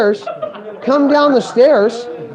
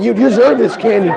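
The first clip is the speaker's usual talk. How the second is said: laughing praise. Retold or imitated.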